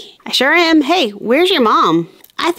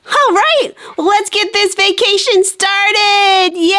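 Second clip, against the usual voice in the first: excited cheer; 7 semitones higher, slower than usual. Acted. Excited cheer after landing.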